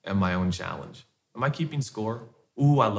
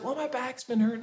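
much higher, softer lightly voiced wife; imitating wife; 13 semitones higher, 5 dB quieter than usual. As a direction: quietly admit it like his wife. Wife's quote voiced in story.

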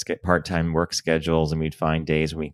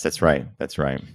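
normal talking voice

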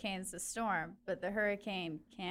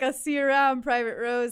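mock commander; 4 semitones higher, 12 dB louder than usual. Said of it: "Chuck's gonna be like".

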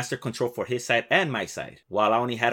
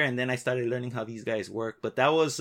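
usual speaking voice

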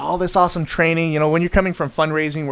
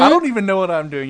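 retold protest; 5 semitones higher than usual. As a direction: protest that you are out of your depth. Continues retold protest to the CEO.

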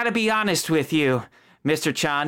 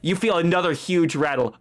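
normal talking voice

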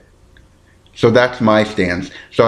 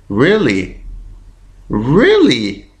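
building disbelief; 4 semitones higher, much slower than usual. Real, not acted. Podcaster learning mustard spoils sooner, growing disbelief. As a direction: stretch it, swooping up then falling. Reacts to what she just read.

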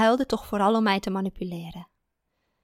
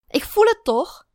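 high, loud hurt retort; wounded furious cry; angry; 12 semitones higher, 9 dB louder than usual. A narrator's reading. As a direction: fire it back in anger. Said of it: "zei ze boos".

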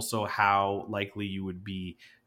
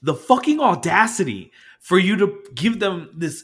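loud, high disbelieving outrage; outraged; 8 semitones higher, 10 dB louder than usual. Retold or imitated. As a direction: spit it out much louder and higher. Venting about the customer.

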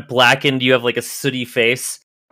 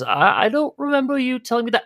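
timid butler; 12 semitones higher, faster than usual. Acted. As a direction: protest meekly that nobody told you. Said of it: "Welsh says".

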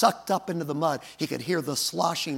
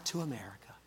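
whisper; 5 semitones lower, 13 dB quieter, faster than usual. Acted. Voice trailing to whisper.

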